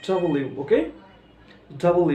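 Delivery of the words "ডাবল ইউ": normal talking voice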